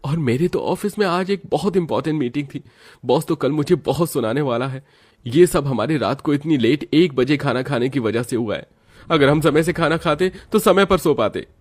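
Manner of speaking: lightly voiced frustrated office worker; annoyed complaint. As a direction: vent at the family for the late dinner. Narrator voices annoyed family member.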